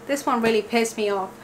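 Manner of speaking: ordinary talk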